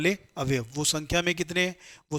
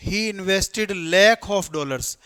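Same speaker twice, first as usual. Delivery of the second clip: slow read-aloud; 2 semitones higher, much slower than usual. Shown as a demonstration. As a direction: stretch each word, "lakh" held long. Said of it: "अब देखो, पढ़ो इसको". (धीरे, lakh पर ज़ोर)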